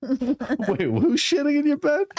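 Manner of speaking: very high mock Indian voice; skeptical Indian accent. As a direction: squeal it in a put-on Indian accent. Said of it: "the skeptical Indian guy".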